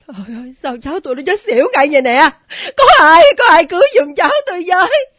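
loud, fast, high wail; tearful, frantic shouting; panicked cry for help. Acted. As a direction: scream for someone to save a child. Grandmother cries for help.

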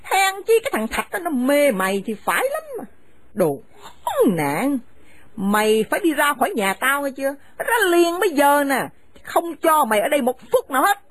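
harsh, contemptuous scolding of a daughter-in-law; bitter older woman driving her son's wife from the house; angry scolding mother. Acted. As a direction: spit the insults at her and order her out.

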